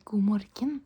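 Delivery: "god morgen" as goo mor-gen; as spelled. Shown as a demonstration. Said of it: "because that's how it's spelled".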